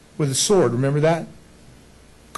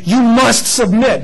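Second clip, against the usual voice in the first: slow, firm mock command; demanding husband; 4 semitones higher, 4 dB louder, slower than usual. Husband ordering his wife in the active voice, demanding. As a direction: lay it down as a husband's order, higher and emphatic. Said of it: "The husband then could say".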